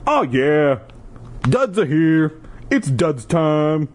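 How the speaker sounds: put-on Jersey Shore accent. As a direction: hype it up like a Jersey Shore bro. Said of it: "my Jersey Shore impersonation".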